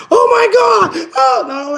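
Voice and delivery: high, loud, stretched-out gasp of shock; shocked shout. Real, not acted. Reading chat live, real shocked shout. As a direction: yell it like you can't believe what you just read. Reacting to a surprising chat comment.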